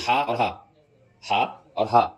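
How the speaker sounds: "ہا اور ہا": first throat ح, second plain ہ; two distinct h sounds. Shown as a demonstration. Teacher separates the letters, two distinct h sounds. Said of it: "یہ ایک دوسرے سے ممتاز ہو جائے".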